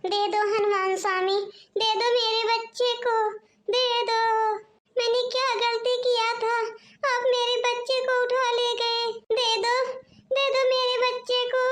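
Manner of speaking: put-on mother pleading with the monkeys holding her baby, wailing; anguished begging. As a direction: cry out begging for your child back.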